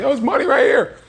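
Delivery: very high sing-song lure; tempting call. Retold or imitated. Corner money calling out to him, very high and tempting. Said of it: "Calling me, like".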